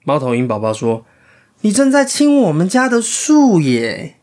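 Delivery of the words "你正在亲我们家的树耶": 猫头鹰宝宝. Acted.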